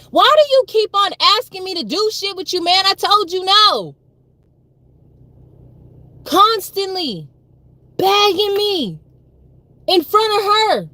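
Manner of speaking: high, loud exasperated yelling; retold angry refusal. Telling the pushy man off, high and loud. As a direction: throw the no back at him, loud. Retelling her refusal to him.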